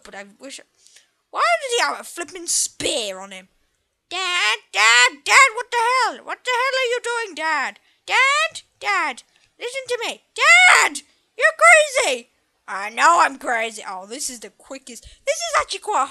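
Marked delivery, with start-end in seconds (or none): 4.1-12.3 put-on higher, loud, alarmed kid yelling at his dad; a frightened boy scolding a reckless father; panicked child voice. Son yelling at his dad for riding wildly, in a high, alarmed kid voice.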